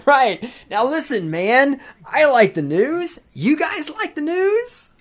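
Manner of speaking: loud, very high, slowed-down mimicry; hammed-up drawl rising high on each question; catchphrase imitation. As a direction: ham up his catchphrase, stretched and high. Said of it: "That's how he does it".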